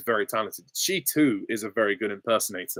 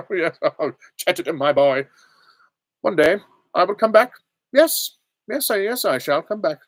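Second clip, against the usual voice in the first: put-on high-pitched, doddering old doctor; theatrical elderly time traveller; William Hartnell; 8 semitones higher, 6 dB louder than usual. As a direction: pitch it up, fussy and halting. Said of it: "impression of William Hartnell".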